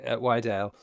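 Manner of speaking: normal talking voice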